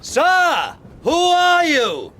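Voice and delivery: loud hail across the ice; shouting. Acted. Captain hails a stranger on the ice, shouting. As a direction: call out high and loud to a stranger.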